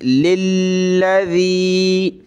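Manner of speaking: slow, drawn-out tilawat; Quran recitation. Shown as a demonstration. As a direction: recite the verse slowly with melody. Recites slowly. (धीमी सुरीली तिलावत)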